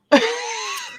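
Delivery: squeaky burst of laughter; high-pitched giggle. Real, not acted. Laugh at the memory.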